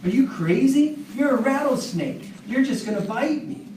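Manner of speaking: a farmer who can't believe the request; incredulous farmer. Acted. Farmer refuses the snake.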